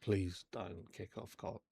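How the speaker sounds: very soft, slow appeal; hushed plea. Retold or imitated. Liz begging her not to kick off, hushed. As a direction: plead under your breath, drawn out. Liz pleads with angry teller.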